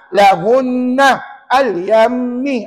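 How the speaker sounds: lahunna and al-yammi, slow with the nn and mm held; drawn-out ghunna. Shown as a demonstration. Ustaz explaining the joined reading, slow held ghunna. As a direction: stretch the nasal sounds slowly. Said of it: "Dia akan jadi".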